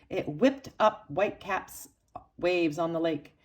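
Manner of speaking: plain reading voice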